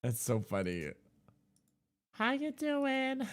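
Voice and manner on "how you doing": put-on high, slow girly voice; a guy going high and sweet for a girl voice; man doing a woman's voice. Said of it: "say it in your girl voice".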